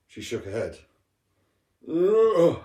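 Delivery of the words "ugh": a loud, high sleepy yawn-groan. Acted. Woken sister yawns at her brother, high yawning groan. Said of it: "she yawned".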